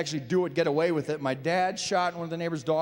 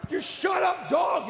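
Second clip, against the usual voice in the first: high-pitched annoyed mock shout; comic exasperated yell; yelling at dog; 11 semitones higher, slower than usual. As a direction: holler at the neighbor's dog. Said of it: "yell at your neighbor's dog".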